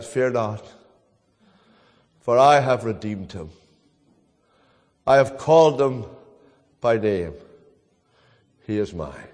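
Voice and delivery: low and soft, falling to near silence; a gentle heavenly reassurance over a deathbed; hushed voice from heaven. Retold or imitated. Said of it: "And a voice from heaven said".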